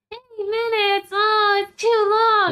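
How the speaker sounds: put-on high whiny child voice; whiny little kid. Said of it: "little kids hear that and they're like".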